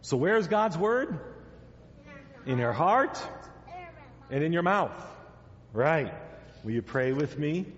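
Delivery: ordinary talk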